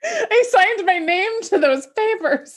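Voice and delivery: mock-guilty laugh, pitched well up. Real, not acted. Friend admits she abandoned her doll, laughing. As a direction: confess through real giggles. Joking guilt over a broken oath.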